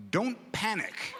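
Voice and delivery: put-on gently calm the child; reassuring parent. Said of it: "They said".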